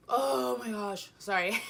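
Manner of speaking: loud burst of laughter through words; laughing. Real, not acted. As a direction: crack up while saying it. Bursts out laughing mid-story.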